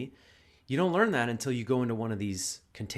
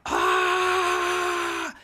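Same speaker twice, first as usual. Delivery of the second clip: loud high cathartic shout; one raw yell of release; 14 semitones higher, 8 dB louder than usual. Retold or imitated. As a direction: let the pent-up anger burst out. Demoing the man's release shout.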